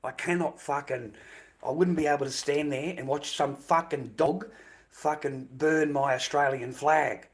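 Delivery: normal talking voice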